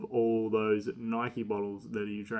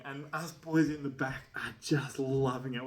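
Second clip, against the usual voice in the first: usual speaking voice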